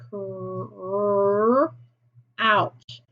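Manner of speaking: "Cr-, ouch" with a long held r, then a break; slow phoneme-by-phoneme split of "Cr-, ouch"; sounding out. Shown as a demonstration. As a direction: hold the r in "Cr-", pause, then "ouch". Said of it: "let's figure out what it is".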